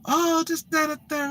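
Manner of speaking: imitating her high, soft prattle; chattering woman. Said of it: "she talking my ear".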